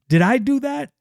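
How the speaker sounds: high, startled question; shocked. Acted. Grandmother stunned at her own power, high and shocked. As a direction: gasp it out in disbelief. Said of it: "So she's shocked".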